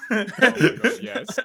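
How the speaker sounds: laughing oh my God; amused laugh. Real, not acted. Cracking up at the story.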